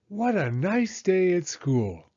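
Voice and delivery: plain reading voice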